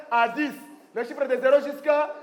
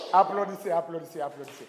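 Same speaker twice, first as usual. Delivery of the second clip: fading applause cue; 7 semitones lower, 5 dB quieter, faster than usual. Real, not acted. Teacher to class after the syllables, fading away. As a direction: start soft, sink lower and quieter.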